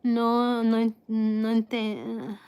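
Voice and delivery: normal talking voice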